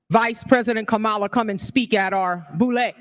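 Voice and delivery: ordinary delivery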